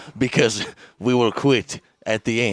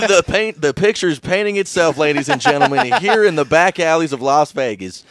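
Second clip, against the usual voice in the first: loud, raised ring-announcer delivery; fight commentator; 4 semitones higher, 5 dB louder than usual. Pretend. Commentating an imaginary back-alley fight, loud announcer voice. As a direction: announce it like a mock title fight. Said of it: "they start commentating it".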